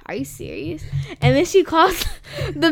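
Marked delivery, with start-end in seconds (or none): 0.1-0.8 imitating a whispered, incredulous question; hushed disbelief. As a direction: whisper it, as if mimicking his disbelief. Retold artist reacts in disbelief.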